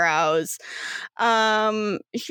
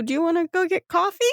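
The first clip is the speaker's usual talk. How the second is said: high, fast bubbly question; chirpy group invite; 9 semitones higher, much faster than usual. Acted. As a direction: rattle it off brightly together.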